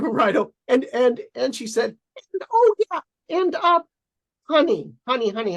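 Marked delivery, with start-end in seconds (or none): 2.3-5.5 doting parent voice. Retold or imitated. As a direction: gush like a doting parent on the phone. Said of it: "How do you guys like [name]'s acting".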